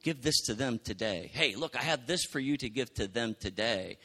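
soft, low, tender prompting; gentle Jesus voice. Retold or imitated. Preacher voicing Jesus nudging a believer, soft and tender. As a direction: lean in and whisper-nudge kindly. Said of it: "he's like".

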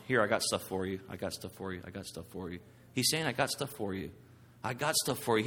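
soft, low voice like offering a gift; God offering gently. Pretend. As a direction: coax them quietly, holding out something. God offering gifts softly.

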